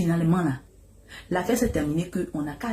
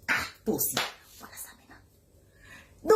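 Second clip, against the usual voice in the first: exasperated sigh; 6 dB quieter than usual. Retold or imitated. Exasperated aside.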